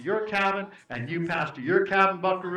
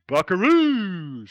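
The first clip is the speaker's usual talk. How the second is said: announcer drawl; 6 dB louder, much slower than usual. Acted. Announcing the boys' team name.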